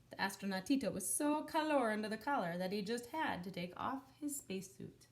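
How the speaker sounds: plain reading voice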